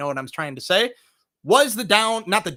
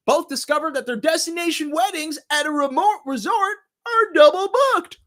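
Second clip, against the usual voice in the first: climbing higher and louder to a mock-horrified finish; hammed-up synopsis reveal; 10 semitones higher, 4 dB louder than usual. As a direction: build to a dramatic high-pitched reveal. Hammed-up reading of film synopsis.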